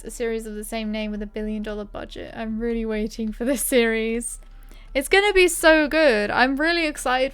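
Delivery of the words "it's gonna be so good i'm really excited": excited. Real, not acted. Eager about upcoming series.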